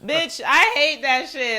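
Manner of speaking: loud, slow disgusted complaint; emphatic. Real, not acted. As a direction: spit it out, fed up.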